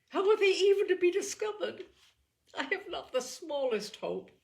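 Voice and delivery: high and loud, near tears; high, loud despair. A narrator's reading. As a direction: cry out the hopelessness. Elizabeth's hopeless outburst.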